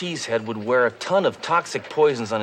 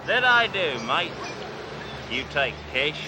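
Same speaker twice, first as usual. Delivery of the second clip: cocky Australian car dealer bragging to a salesman; fake Australian accent; 5 semitones higher than usual. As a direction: play the brash outback tycoon Crocodile Bruce. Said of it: "Name's Crocodile Bruce".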